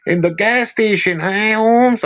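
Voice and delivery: teasing put-on Chicano accent; mock Mexican accent. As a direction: tease in a hammed-up Mexican accent. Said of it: "making fun of accents".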